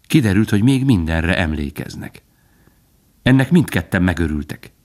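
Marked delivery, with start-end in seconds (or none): none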